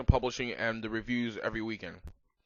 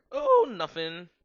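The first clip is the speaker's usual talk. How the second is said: innocent dodge; 7 semitones higher, 8 dB louder, much slower than usual. Acted. Covering an awkward slip.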